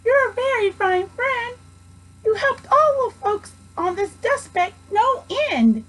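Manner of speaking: a speck-sized person thanking with emotion; tiny grateful voice. A narrator's reading.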